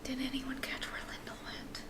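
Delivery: hushed whisper to the party. Real, not acted. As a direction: whisper it so no one hears.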